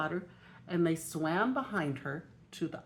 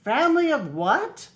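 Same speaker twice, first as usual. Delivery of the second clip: high, loud astonished question; can't believe what he's hearing; Clancy at headquarters, astonished; disbelieving; 8 semitones higher, 9 dB louder, slower than usual. A narrator's reading. Clancy hears about ducks.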